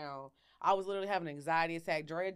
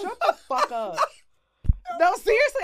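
laughing shout; 13 semitones higher, 12 dB louder than usual. Real, not acted.

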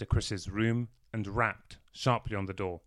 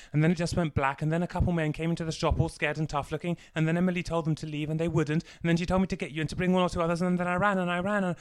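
put-on high, fast, loud tumbling child's words; breathless babbling; 8 semitones higher, 5 dB louder, faster than usual. A narrator's reading. Boy telling Chris about the blackout, breathless and fast. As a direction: pour it all out without stopping for air. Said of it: "babbling breathlessly".

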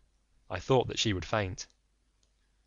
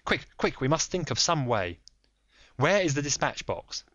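lightly voiced firm detective; polite but firm, a touch raised; Holmes; 6 semitones higher, 3 dB louder than usual. Holmes pressing the lady, lightly voiced firm.

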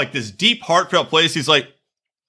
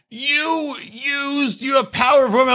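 high, yelled mock of Kyle; mock shouting Kyle; 6 semitones higher than usual. Imitating Kyle's rant at Luke, high mock yell. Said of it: "and Kyle".

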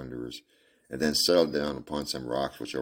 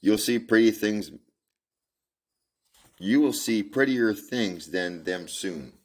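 gentle comfort; 5 semitones higher, slower than usual. Acted. Man comforting the girl.

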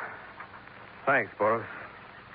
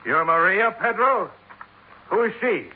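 startled question, pitched higher; surprised; 7 semitones higher than usual. Acted. Dollar startled by Pedro's mention of Maria, surprised. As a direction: catch him off guard.

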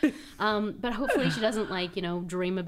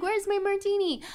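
much higher, prissy demand; demanding diva; 12 semitones higher than usual. Acted. Queen bee demanding a drink in a high prissy voice. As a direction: ask for your drink like a spoiled socialite. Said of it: "She's like".

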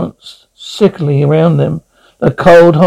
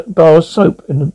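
plain reading voice

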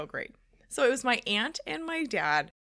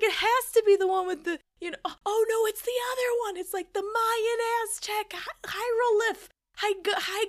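high-pitched frantic guessing; acting out a flustered game player; frantic yelling; 10 semitones higher, 4 dB louder than usual. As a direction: stumble excitedly towards the word. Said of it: "I'm yelling and I'm screaming".

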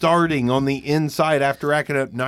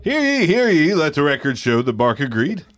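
town crier; 2 semitones higher than usual. Pretend. Mock proclamation of agreement.

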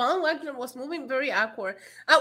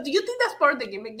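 normal talking voice

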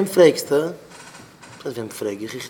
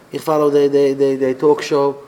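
ordinary talk

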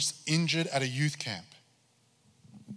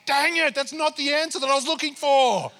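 much higher, loud disappointed whine; frustrated; 14 semitones higher, 7 dB louder than usual. Retold or imitated. Pastor replaying his letdown at God's answer, whiny and high. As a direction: groan it like a thwarted kid.

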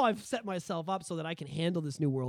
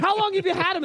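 high loud mock father asking; mock dad voice; 11 semitones higher, 7 dB louder than usual. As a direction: play the eager dad, pitched up. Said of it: "Be like".

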